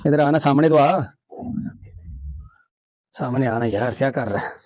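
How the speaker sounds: quiet sweet-talk; soft coaxing. Real, not acted. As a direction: gently urge the animal forward. Coaxing the bull forward.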